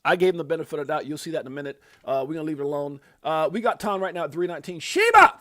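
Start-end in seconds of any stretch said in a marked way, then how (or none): 4.8-5.4 high, loud yell of a name; sudden excited shout; shouted callout. Real, not acted. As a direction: holler it like a hype man. Calling out a coin name.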